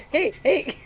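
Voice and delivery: ordinary talk, nothing marked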